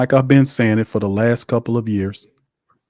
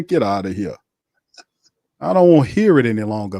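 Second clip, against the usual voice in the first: usual speaking voice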